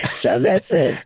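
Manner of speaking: high, still laughing through the words. Real, not acted. Son finishing the story of his father, laughing. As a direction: let the laugh carry the line.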